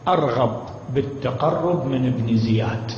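low, slow, cold killer's voice; voicing the killer. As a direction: let the words drag out coldly and greedily. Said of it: "قال لهم".

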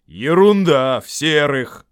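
loud, high troll contradiction; Вильям. A narrator's reading. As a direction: dismiss it as a bickering troll. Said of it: "похожий на Вильямов".

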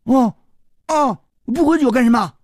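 high-pitched naive oohs and question; puzzled boy. Acted. Boy baffled by his friend's hint, high puzzled voice. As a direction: sound clueless, pitched well up.

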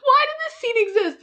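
very high exasperated squeal; exasperated disbelief. Real, not acted. Recapper baffled by the pooping scene, exasperated disbelief. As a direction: wail it in high-pitched disbelief.

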